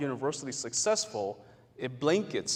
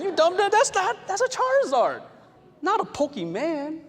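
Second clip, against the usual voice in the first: much higher, louder outraged scoff; indignant gamer; 6 dB louder than usual. Pretend. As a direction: scold a clueless player, appalled. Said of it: "You're like".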